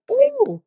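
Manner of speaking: high squealing ooh of shock; retold high ooh. Vlogger reacting to gas prices with a high shocked ooh. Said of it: "I was like".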